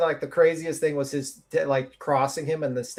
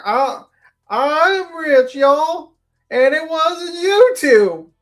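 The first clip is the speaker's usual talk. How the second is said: high, loud, hammed-up Southern wail; mock a Southern drawl, high and stretched out; bad Southern holler, drawn out and whiny; shitty Southern accent; 11 semitones higher, 5 dB louder, much slower than usual. Shown as a demonstration. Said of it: "my shitty Southern accent".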